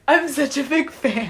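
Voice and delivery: mock starstruck gush; gushing fan. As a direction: imitate an excited fan, lightly hammed up. Acts out gushing fan meeting robot.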